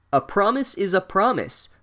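bossy reminder. A narrator's reading. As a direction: remind him with a touch of bossiness. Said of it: "Bossy Girl reminded Harry bossily".

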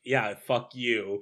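slow, sneering brush-off; a lightly voiced snotty Hamlet; bitchy Hamlet. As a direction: sneer it like a spoiled brat. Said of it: "Hamlet is a bitchy little shit who goes like".

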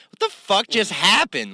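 high, slow, drawn-out disbelief; stunned disbelief. Retold or imitated. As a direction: mimic the stunned crowd. Audience stunned at comedy show.

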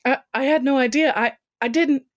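high, halting stammer; acted fluster, tripping on each start. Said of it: "Melissa stammered as she tried to find the words".

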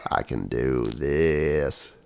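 extremely low, very slow ASMR murmur; mock ASMR voice. Podcaster persisting with ASMR bit despite co-host's protest, deep slow voice. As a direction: stretch each word out deep and intimate. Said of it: "This is some A S M R".

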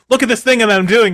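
high, loud show-off voice. Retold or imitated. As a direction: crow it like a proud kid. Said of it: "you're like".

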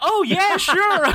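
very high, loud mock-cheery server; a hammed-up sugary server; chirpy American server. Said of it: "And they're like".

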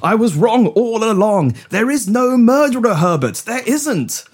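high, insistent dramatic delivery; stagey eureka excitement; excited revelation. Acted. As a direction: declare your discovery with rising fervour. Announces his realisation.

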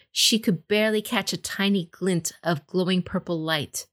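usual speaking voice

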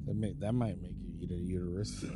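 normal talking voice

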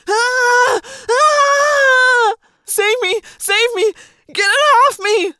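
shrill, very high cries for help; horrified screaming. A narrator's reading. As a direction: scream to be rescued, high and loud. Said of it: "shrill, horrified scream of Jeremy Jason".

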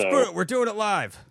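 loud, high rant quote; mock rant. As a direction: bellow the famous line. Quoting famous rant line.

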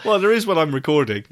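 ordinary talk, nothing marked

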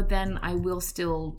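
normal talking voice

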